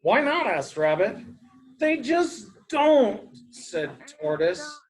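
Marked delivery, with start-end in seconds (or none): 0.0-0.5 curious rabbit. Acted. Rabbit questions grumpy Tortoise. 1.8-3.3 slow, high, stubborn retort; grumpy tortoise. A narrator's reading. Tortoise brushes off Rabbit's question, slow and grumpy. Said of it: "It's a grumpy tortoise".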